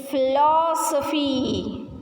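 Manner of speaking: "philosophy" very slow, "LO" stretched; stressed vowel demo. Shown as a demonstration. Teacher has students practice saying this, "philosophy" drawn out on "lo". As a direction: lean long on the "lo" of "philosophy". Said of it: "Stress the vowel sound".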